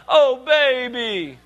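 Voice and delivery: slow, drawn-out comforting coo from a mom. Retold or imitated. Pastor imitates a mom cooing at her crying kid, slow and drawn out. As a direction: stretch it out like a mother soothing her burnt child. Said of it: "And his mom is like".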